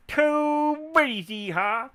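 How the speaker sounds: high, stretched-out cowboy whoop; hammed-up Texan holler, drawn out slow; Texas yeehaw. Celebrating his video count with a Texan yeehaw whoop. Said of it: "That's the Texas in me".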